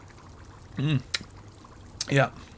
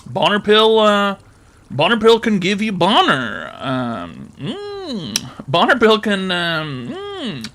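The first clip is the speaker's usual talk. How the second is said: upbeat fake commercial, higher pitch; cheerful ad with eerie menace; 5 semitones higher, slower than usual. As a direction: sell it like a creepy TV ad. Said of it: "Cash App advertisement".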